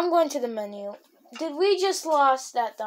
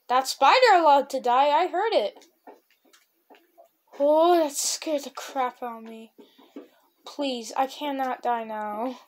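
ordinary talk

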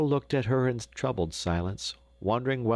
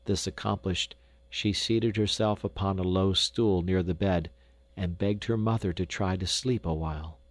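usual speaking voice

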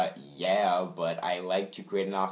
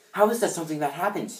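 rushed, higher-pitched disbelief; too fast; 5 semitones higher, faster than usual. Acted. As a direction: rattle the question out too quickly. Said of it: "I said that too fast".

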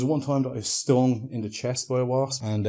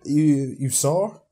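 attempted Polish accent; 3 semitones higher, 7 dB louder, much slower than usual. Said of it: "there was this Polish woman".

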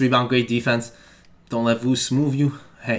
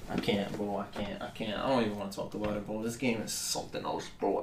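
very quiet, low and resigned; defeated mumble; 5 semitones lower, 14 dB quieter than usual. Real, not acted. Gamer doesn't even want to talk about it, defeated low mumble. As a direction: say it under your breath, giving up.

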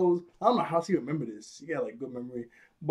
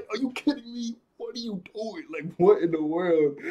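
put-on higher, scolding voice of a girl in disbelief; mock outraged Yumi; 5 semitones higher than usual. Yumi scolding Jeremy on the phone, mock outraged and high. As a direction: play the exasperated friend, pitched up. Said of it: "she was like".

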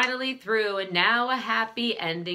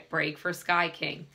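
usual speaking voice

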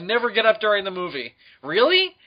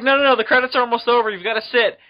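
higher, fast insistent push; insistent friend; 7 semitones higher, 5 dB louder, faster than usual. Retold or imitated. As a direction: rush the friend's insistence, pitched up. Jake insisting in retold scene.

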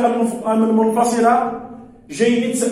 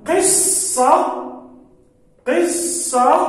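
slow and higher, syllable by syllable; drawn-out word; 6 semitones higher, much slower than usual. Shown as a demonstration. Teacher dictating قصة to pupils, very slow. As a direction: stretch قصة out so pupils hear each sound. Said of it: "الكلمة الرابعة لدي".

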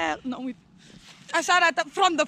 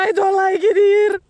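put-on whiny kid voice, louder; mock crying child; 5 semitones higher, 9 dB louder than usual. Answering her friend's Mommy joke, mock childish wail. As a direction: pretend to be a crying little kid.

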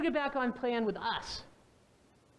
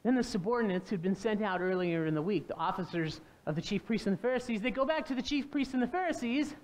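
ordinary talk

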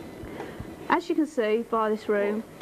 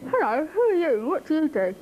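higher, perky sing-song frog; joke frog voice; 6 semitones higher than usual. Acted. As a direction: voice an inquisitive little frog in a joke. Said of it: "And he goes".

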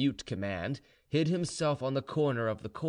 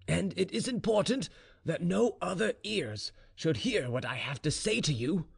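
higher-pitched, deliberate father; lightly voiced, serious general; insistent general; 7 semitones higher than usual. A narrator's reading. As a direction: insist firmly on privacy.

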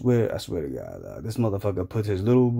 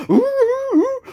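very high, loud comic wails; mock yelps; 9 dB louder, slower than usual. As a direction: squeal like someone being beaten. Acts conservative being beaten.

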